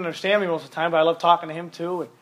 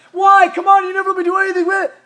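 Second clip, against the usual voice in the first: high, loud, fast bratty complaint; whiny teen protest; 13 semitones higher, 8 dB louder than usual. Acted. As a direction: whine like a spoiled teenager.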